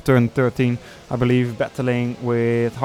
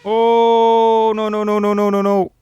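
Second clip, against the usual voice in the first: higher, louder despairing cries; dismayed no no no; 9 semitones higher, 6 dB louder than usual. Real, not acted. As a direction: groan it as the car crashes. Teammate crashes out of race.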